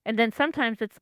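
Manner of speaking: usual speaking voice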